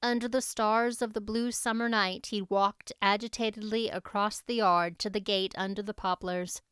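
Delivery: plain reading voice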